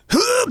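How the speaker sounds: very high straining scream; Vegeta heave scream. Retold or imitated. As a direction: scream out a heave like a powering-up warrior. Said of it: "I'm going full Vegeta".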